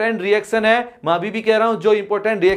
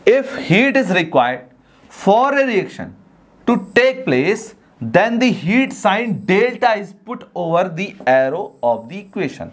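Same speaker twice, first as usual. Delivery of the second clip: very slow, word-by-word dictation of a definition; much slower than usual. Shown as a demonstration. Teacher dictating a definition to students, very slowly. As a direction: read it out slowly so the class can write it down.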